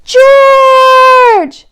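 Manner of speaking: high, loud call of his name. Acted. Man with the yellow hat calls up to George. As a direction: call him from far below. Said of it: "Then he heard someone call".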